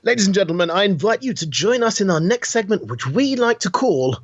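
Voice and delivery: showman's intro, slowing down on the title; grand announcer. Acted. Cohost introducing the podcast segment, hammed-up announcer. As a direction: present the segment like a game show host. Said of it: "if you announce week that was".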